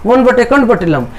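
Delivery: quick, joined-up reading without breaks; brisk teacher's demonstration; read quickly. Shown as a demonstration. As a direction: run the whole fraction together fast. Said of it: "इसको तेज पढ़ेंगे".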